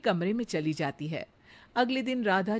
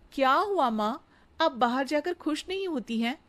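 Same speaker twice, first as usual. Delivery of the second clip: put-on higher, eager young bride's voice; sweet daughter-in-law; 6 semitones higher than usual. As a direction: flatter the mother-in-law sweetly. Radha asks worried.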